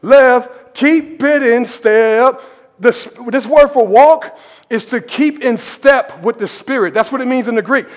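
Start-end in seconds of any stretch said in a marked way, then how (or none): 0.0-2.4 slow drawn-out marching call; military cadence caller. Shown as a demonstration. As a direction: stretch it out, calling the troops into step. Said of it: "had to go off my cadence".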